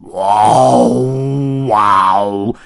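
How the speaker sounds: loud, drawn-out beastly bellow; big wordless bear growl-shout; fierce bear roar. A narrator's reading. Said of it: "发出了一声凶恶的咆哮".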